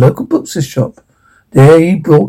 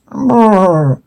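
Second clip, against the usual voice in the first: higher lip-trilled horse noise; horse neigh; 4 semitones higher than usual. Acted. Narrator mimics the horse, neighing. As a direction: imitate a horse with a brr. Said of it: "Horse neighed".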